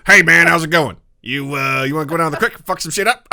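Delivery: a rowdy country buddy calling you to the creek. Pretend. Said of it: "as long as I'm doing this voice".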